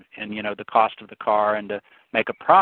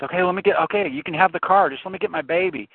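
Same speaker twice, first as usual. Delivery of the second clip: higher-pitched frantic begging, lightly acted; mock terrified plea; 6 semitones higher than usual. Carjacked mother begging the gunman, mildly acted terror. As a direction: plead for the baby like a scared mother. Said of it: "lady's terrified, and she goes".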